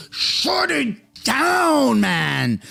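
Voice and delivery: high, drawn-out cry, dropping on "man"; exasperated plea. Real, not acted. Streamer begging for safe supply to end, exasperated. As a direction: stretch it out in high-pitched exasperation.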